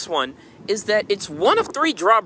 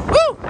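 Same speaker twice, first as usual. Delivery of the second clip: loud high whoop at the wind; 6 dB louder than usual. Real, not acted. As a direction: whoop up high as the gust hits. It's windy.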